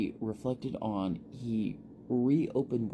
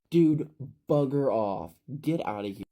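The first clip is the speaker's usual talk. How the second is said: put-on fed-up boy chasing him away; angry dismissal; 5 semitones higher, 5 dB louder than usual. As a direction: send him off hard. Ephraim telling Shelley off.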